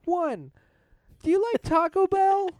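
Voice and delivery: put-on high kid voice with a Delco accent; young boy doing a survey, Delco accent. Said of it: "my Delco accent".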